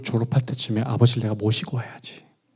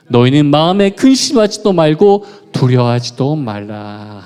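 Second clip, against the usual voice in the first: louder, measured recitation of the verse; scripture reading aloud; 7 dB louder than usual. Shown as a demonstration. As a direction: read it out to the congregation together. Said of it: "말씀 다시 한번 읽어볼까요? 시작".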